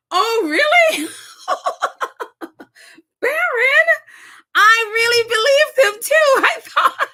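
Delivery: high, loud and cracking up; host laughing at having believed Barron's prank, incredulous; laughing disbelief. Real, not acted. Real laughter breaking through a high shout.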